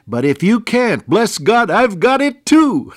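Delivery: high, loud, exultant outburst; loud rejoicing, last words stretched out; jubilant shout. Retold or imitated. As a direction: erupt into joyful revival shouting. Porter bursts into shouting himself.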